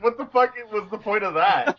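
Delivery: high, disbelieving what-the-fuck; reacting in baffled disbelief; incredulous outburst. Friend retelling his disbelief at a pointless scene. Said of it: "I was like".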